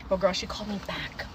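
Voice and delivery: normal talking voice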